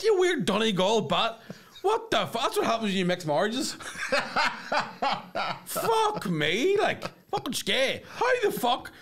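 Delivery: high-pitched, laughing rant. Retold or imitated. Mocking the Donegal shopkeeper, high laughing outrage. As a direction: howl in disbelief, half laughing.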